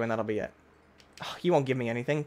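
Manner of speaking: usual speaking voice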